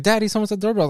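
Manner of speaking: put-on high, childlike call to daddy; a small kid announcing a visitor; daughter's voice. Daughter telling daddy someone is at the door, high and childlike. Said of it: "And she's like".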